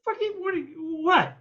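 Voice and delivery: high-pitched, stammering mock shock; acted disbelief. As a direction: splutter as if caught totally off guard. Retelling his shock at the letter.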